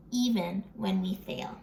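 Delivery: plain reading voice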